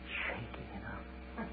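mild hushed tone. Acted. Trembling eaglet after rescue.

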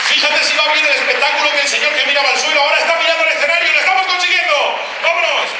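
rally the crowd, voice riding up high; excited hype. Real, not acted. Comic cheering on Isabel and the crowd, excited hype.

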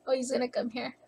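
ordinary talk, nothing marked

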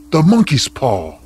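loud, wild outburst; wild cry. Acted. Wife wakes husband with a sudden idea, crying out wildly. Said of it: "she cried wildly".

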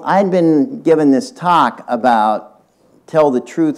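normal talking voice

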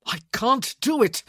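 loud, high cry of refusal; desperate outburst. A narrator's reading. Man refusing what the woman asks, desperate and loud. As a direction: burst out with it, at the limit. Man's outburst continues after yelling.

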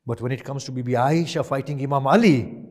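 a preacher working up the crowd; preaching. Acted. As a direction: drive the point home to the congregation. Preacher builds indignation at the crowd.

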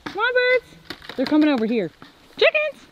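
high, loud call to the birds; calling chickens. Real, not acted. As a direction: call out to the flock.

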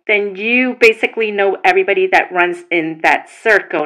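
usual speaking voice

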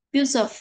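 "Beautiful" as "views of", run into one word; "view-zof", no gap; linked sounds. Shown as a demonstration. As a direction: carry the s into "of" in "Beautiful". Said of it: "những cái nối âm như sau".

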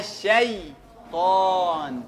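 الشيطان with a slow, drawn-out sha; clear sha sound. Shown as a demonstration. Teacher drilling students on the sha, slow and drawn out. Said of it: "ها، تاني".

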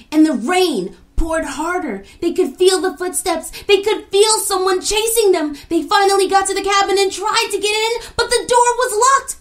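storyteller building to the climax; building panic. A narrator's reading. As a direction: climb to the scary peak.

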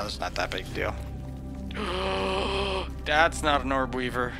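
normal talking voice

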